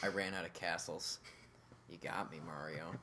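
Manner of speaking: low, soft, underwhelmed mock princess; monotone. Princess out of castles giving up, monotone. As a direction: give up as an unexcited princess. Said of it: "monotone game of hide and seek".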